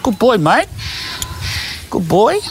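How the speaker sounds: high rising praise for a good dog; puppy praise. Real, not acted. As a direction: reward him with your voice, high and warm.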